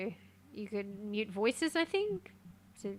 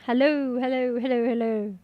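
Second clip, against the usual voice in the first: mic test hellos; 8 dB louder than usual. Shown as a demonstration. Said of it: "if I talk in the game".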